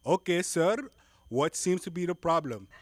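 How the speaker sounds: hammed-up Indian call-centre agent; Indian accent. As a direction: play a Mumbai support rep, loud. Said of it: "agent in call".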